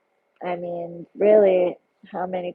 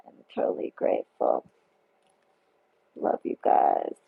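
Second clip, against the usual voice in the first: whispered thanks. Real, not acted. Thanking a viewer, moved.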